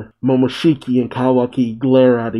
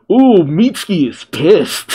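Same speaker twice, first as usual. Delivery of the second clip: drawn-out, higher, slower ooh of relish; higher and slow, thrilled and teasing; gleeful delight; 5 semitones higher than usual. Real, not acted. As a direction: savour the moment like a fan watching a fight brew. Reacting to Mitsuki's cold reply.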